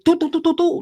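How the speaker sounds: rapid high "doo-doo" volley; playful sound-effect for a flurry; imitated punches. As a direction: fire off high syllables like flying punches.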